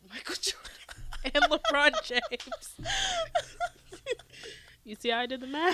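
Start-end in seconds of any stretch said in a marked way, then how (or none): 0.1-2.9 slow, high laughing boast. Real, not acted. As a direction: crack up mid-brag. Boasting, laughing at own joke.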